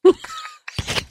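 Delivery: high, helpless squealing laugh; laughing at a friend's laugh. Real, not acted. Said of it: "When Frack laughs like that".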